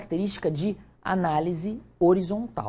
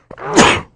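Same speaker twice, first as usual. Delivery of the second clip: loud sudden real sneeze; sneezing; 15 dB louder than usual. Real, not acted. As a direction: sneeze hard into the mic. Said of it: "Eu vou espirrar".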